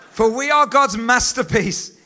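loud ringing proclamation; laughing crowd, preacher booms the verse; proclaimed. Real, not acted. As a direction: call the verse out over the crowd.

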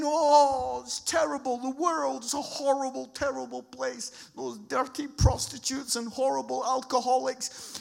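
loud, exaggerated tutting disapproval; mock dismay. Churchgoers deploring the world to each other, mock dismay. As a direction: act out churchgoers lamenting the world.

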